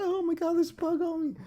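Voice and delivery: very high, hammed-up girly shriek; squeaky mock panic. Jogger sees one bug, absurd high-pitched mock shriek. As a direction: play it as a ridiculous over-the-top freakout.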